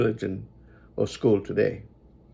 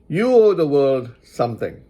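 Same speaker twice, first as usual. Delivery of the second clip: ordinary talk, nothing marked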